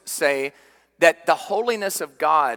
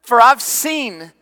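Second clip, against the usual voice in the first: higher, louder, urgent; raised preacher's voice, sustained intensity; impassioned preaching; 8 semitones higher, 6 dB louder than usual. Real, not acted. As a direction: burst out and drive the point home. Preacher surging on prophet's unclean lips.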